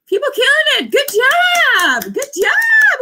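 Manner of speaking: high, loud shouted praise; excited cheering. Real, not acted. Streamer cheering chat for hitting word goals, shouting.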